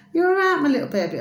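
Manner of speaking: high loud coo, settling down; pet cooing. Retold or imitated. Reassuring the pet bird, high baby talk. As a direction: coo high, then ease back.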